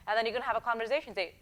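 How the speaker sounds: usual speaking voice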